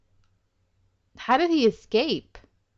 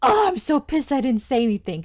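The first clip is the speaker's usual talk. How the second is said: usual speaking voice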